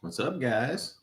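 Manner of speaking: usual speaking voice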